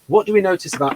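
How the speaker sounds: usual speaking voice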